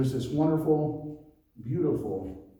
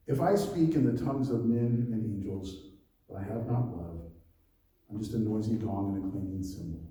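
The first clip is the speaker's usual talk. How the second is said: lower, measured reading of the passage; scripture reading; 5 semitones lower than usual. Acted. Rereads the verses.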